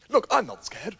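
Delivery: ordinary delivery, nothing marked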